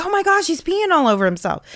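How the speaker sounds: sudden panicked exclamation, hurried; retold alarm. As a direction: mimic a half-asleep dad caught off guard. Husband startled during diaper change.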